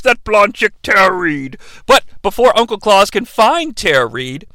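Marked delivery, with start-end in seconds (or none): none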